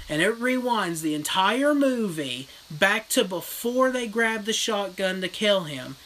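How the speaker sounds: usual speaking voice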